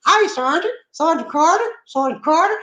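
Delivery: high Gomer Pyle imitation. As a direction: call out to the sergeant like Gomer. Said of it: "And he said".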